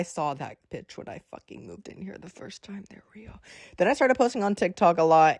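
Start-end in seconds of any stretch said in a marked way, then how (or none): 0.0-3.4 hushed, breath-only confiding; spooky whisper. Real, not acted. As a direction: whisper it like a ghost story, fading away. Spooky confiding about demons.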